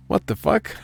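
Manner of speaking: high, startled what the fuck; real disbelief at the snack; shocked outburst. Real, not acted. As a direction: pitch leaps up in outrage. Finds no peanuts in snack.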